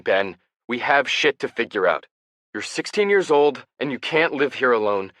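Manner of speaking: high, loud furious rant; angry yelling. A narrator's reading. Brother scolding Ben to get out of bed, angry shout. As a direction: let it all out at him, shouting. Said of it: "Ian never yells at me".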